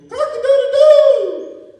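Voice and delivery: high, drawn-out rooster crow. Acted. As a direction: crow it loud and long like a rooster. Little eagle imitates rooster.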